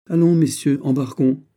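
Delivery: high, loud call to board; shouted call. A narrator's reading. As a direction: call the party aboard. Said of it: "cria le fils de Kaiser". (appel crié, fort et aigu)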